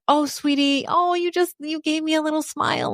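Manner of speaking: put-on high, gentle baby talk. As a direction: coo at a newborn very high. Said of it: "we are so gentle".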